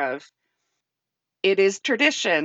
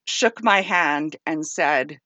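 usual speaking voice

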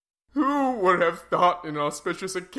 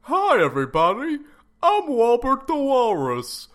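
walrus mascot voice; 3 semitones higher than usual. Acted. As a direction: pitch it like a goofy mascot.

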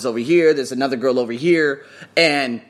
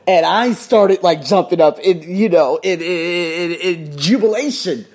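excited retelling; 3 semitones higher, 5 dB louder, slower than usual. Real, not acted. Telling of jumping for joy.

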